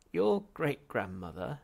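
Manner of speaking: slow, high, loud incredulous muse; musing. Acted. Ghost musing to himself, slow and loud. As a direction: ponder it aloud slowly. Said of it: "mused the gaunt little figure to himself".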